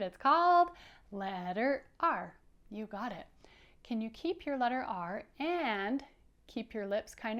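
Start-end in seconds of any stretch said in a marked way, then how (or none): 0.0-2.3 teacher emphasis; clear emphasis. Shown as a demonstration. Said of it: "Did you hear it?".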